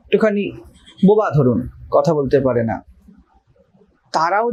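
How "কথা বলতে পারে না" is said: ordinary talk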